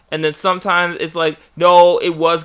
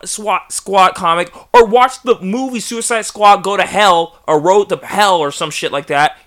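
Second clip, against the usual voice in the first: usual speaking voice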